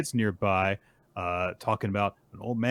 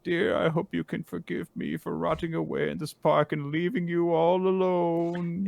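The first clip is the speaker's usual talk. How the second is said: put-on high old-man lament, slowing at the end; hammed-up sorrowful old-man farewell; mournful old man; 10 semitones higher than usual. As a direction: plead skyward to a beloved wife. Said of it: "he looks up into the sky and he's like".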